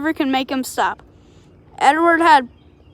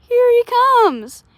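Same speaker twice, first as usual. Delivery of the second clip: put-on little boy's high, slow shout of joy; 9 semitones higher, 4 dB louder, slower than usual. Little boy at the station shouting that the guard is coming. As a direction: call out high and drawn out. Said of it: "a little boy shouted".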